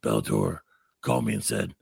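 low mock action-star storytelling; Steven Seagal. Host re-enacts Seagal interview about a fighter, low sinister voice. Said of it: "And he's like".

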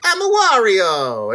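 drawn-out, slow mock-Italian cry; cartoon Italian accent. Mocking the dumb old joke, exaggerated Italian voice. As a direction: stretch it like a game character. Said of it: "thirty years later that we're still like".

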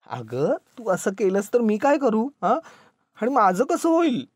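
high, drawn-out helpless plea; panicked husband rising to a wail; bewildered, near tears. A narrator's reading. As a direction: plead as if about to cry. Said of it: "इतका भांगरून गेला".

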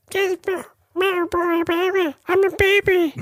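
put-on tiny, very high baby creature voice; very high-pitched baby talk; Gizmo. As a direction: squeak it out like a baby critter. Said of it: "Gizmo was like".

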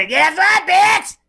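high, loud hammed-up screech; mimicked shout. Co-host copying a viral clip, high mock shriek. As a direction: shriek it way up high, imitating a rapper's viral rant.

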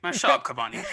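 usual speaking voice

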